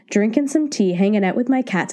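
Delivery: normal talking voice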